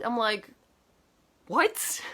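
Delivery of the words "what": higher, rising what; disbelieving what. Retold or imitated. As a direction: let the disbelief jump up. Disbelief at the director's advice.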